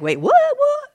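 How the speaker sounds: very high, loud mock alarm; startled crowd. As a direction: yelp it like walkers bumping to a halt. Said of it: "bumping into each other and like".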